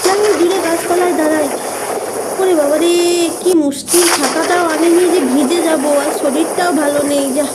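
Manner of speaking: put-on alarmed girl. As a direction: panic as the rain starts. Caught in rain, alarmed. (বৃষ্টিতে চমকে ওঠা ভয়ার্ত গলা)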